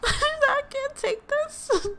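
very high, slow laughing wail; helpless laughing. Real, not acted. Gamer overwhelmed by chaos in the game, helpless laughing. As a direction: squeal it through laughter.

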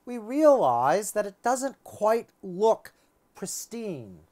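ordinary talk

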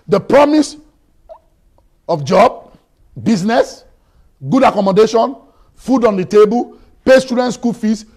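loud, high-pitched preaching at full force; fiery preaching. Acted. Pastor preaching hard to the congregation, loud and high. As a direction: hammer it home to the congregation.

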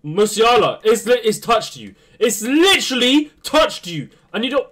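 high, loud, pleading yell at the player; frustrated shout. Real, not acted. Gamer yelling at his player for missing the ball, exasperated shout. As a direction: drag it out in disbelief.

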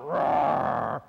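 low, loud mock ape roar; hammed-up movie monster roar; King Kong roar.

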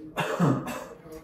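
single short cough; cough on request. Real, not acted. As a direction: give one short cough. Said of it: "Can you please cough for me?".

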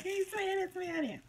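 high, squeaky mock viewer. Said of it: "You're like".

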